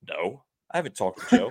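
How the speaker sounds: retold answer with a laugh in it; imitating Rory while laughing; laughing quote. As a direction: chuckle through Rory's blunt reply. Retelling Rory's answer to announcer.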